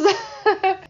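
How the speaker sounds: bright burst of high laughter; short giggle at own joke; real laugh. Real, not acted. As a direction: laugh at yourself.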